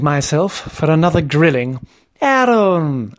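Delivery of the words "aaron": hearty, higher-pitched greeting; hearty exclamation. A narrator's reading. Narrator greeting Aaron before apologising, hearty exclamation. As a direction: hail him heartily, pitched up. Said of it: "I exclaimed heartily".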